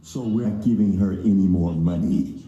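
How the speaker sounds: low, loud banker refusal; stern banker. Acted. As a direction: turn him down flat and heavy.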